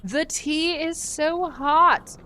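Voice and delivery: loud, high, slow character voice; high mock wail. Playful high acted game line.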